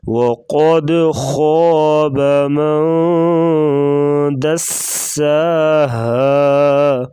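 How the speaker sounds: دساها with a hard hissing س; slow chant; tajweed recitation. Shown as a demonstration. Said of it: "سین سختی سے پڑھیں گے".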